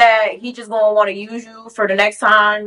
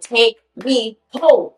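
slow, spaced-out order; firm demand; much slower than usual. Retold or imitated. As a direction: demand it slowly, word by word. Retold firm demand to leave.

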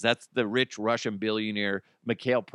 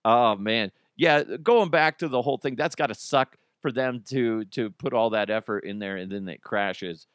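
usual speaking voice

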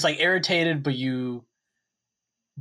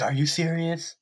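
exasperated disbelief, pitched up; mock frustrated; 5 semitones higher than usual. Acting out his photo look to co-host, mock frustrated. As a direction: put on mild frustration. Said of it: "frustrated, about to cry, but doesn't cry".

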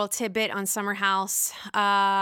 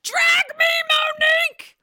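very high, loud hammed-up yell; mock fight shout; 7 dB louder than usual. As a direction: shout it like a reality-show brawl catchphrase. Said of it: "Is this gonna be another".

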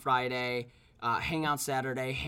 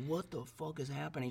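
soft, just-woken mumble of confusion; groggy; 10 dB quieter than usual. Retold or imitated. Man retelling his own sudden waking beside girlfriend, groggy. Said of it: "like, groggy".

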